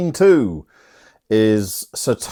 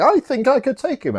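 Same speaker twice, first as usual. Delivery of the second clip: tag. Retold or imitated. Voicing cocky Andrew in retelling.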